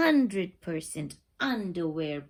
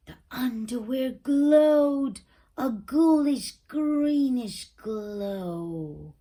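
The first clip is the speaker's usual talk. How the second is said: louder, slow eerie drawn-out voice; spooky reveal; 4 semitones higher, 6 dB louder, slower than usual. A narrator's reading. Narrator thrilling young listeners with the glow, eerie and slow. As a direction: stretch out ghoulish like a ghost story.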